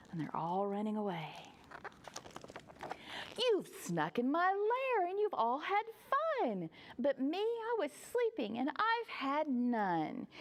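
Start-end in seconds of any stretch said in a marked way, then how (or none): 3.4-10.3 high, hurt storybook complaint; high, swooping pouty protest; wailing bear. Acted. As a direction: whine it like a left-out bear.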